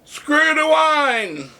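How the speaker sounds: high, drawn-out answering call; mock yell held high, without much volume; retold yell back. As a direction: echo the chant back, long and high. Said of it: "a couple of people yelled back to me".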